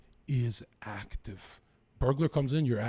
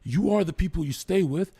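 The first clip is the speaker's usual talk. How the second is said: higher, louder retold scolding; higher, louder mock scolding of a partying younger brother; 7 semitones higher, 4 dB louder than usual. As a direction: lay into him like an older brother. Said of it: "He said".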